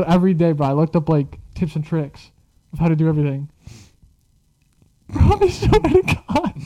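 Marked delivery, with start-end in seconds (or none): 5.1-6.5 laughing. Real, not acted. Laughing through story.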